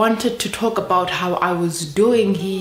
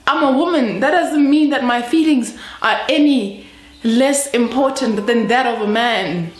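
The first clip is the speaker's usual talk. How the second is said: higher-pitched, impassioned self-defence; indignant protest; 6 semitones higher, 4 dB louder than usual. Acted. Woman insisting her feelings count as much as a man's, indignant. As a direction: protest heatedly, pitch climbing.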